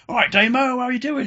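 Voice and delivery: high, loud mock hello; acted hearty greeting. Inmate greeting a new arrival he knows, hearty acted shout. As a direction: holler to an old mate.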